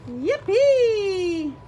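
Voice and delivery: loud, high yippee; cheering. Acted. The happy animals celebrate, loud high cheer.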